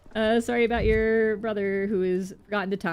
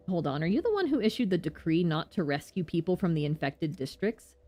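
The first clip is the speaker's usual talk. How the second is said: normal talking voice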